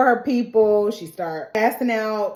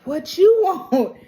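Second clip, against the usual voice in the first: slow, higher, louder sassy challenge; sassy mock Renee; 8 semitones higher, 4 dB louder, slower than usual. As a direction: toss it out with a laughing sneer. Renee snaps at Allison in retelling.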